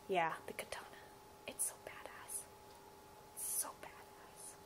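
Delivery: breathy secret whisper. Real, not acted. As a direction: drop to a hushed, reverent whisper. Gushing over favorite weapon.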